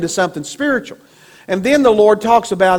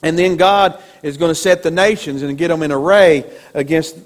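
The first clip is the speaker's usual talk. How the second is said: usual speaking voice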